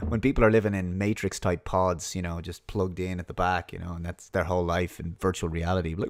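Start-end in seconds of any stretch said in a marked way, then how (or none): none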